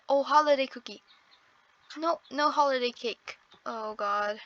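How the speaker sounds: usual speaking voice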